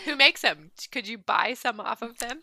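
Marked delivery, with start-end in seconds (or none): 0.1-0.7 laughing while asking. Real, not acted. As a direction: ask through a laugh. Laughing question.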